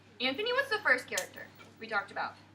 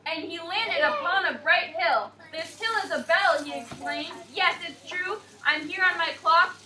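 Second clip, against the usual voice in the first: bright, higher read-aloud voice; sing-song picture book narration for little kids; storybook reading; 3 semitones higher, 4 dB louder than usual. A narrator's reading. Teacher reading rhyming story to class, bright storybook voice.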